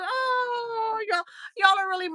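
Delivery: high, tearful voice crying with gratitude; choked up, real happy crying; tears of joy. Real, not acted. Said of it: "these are tears of, of joy".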